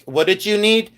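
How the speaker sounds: drive-thru computer. Retold or imitated. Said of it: "the computer, didn't understand. And it goes".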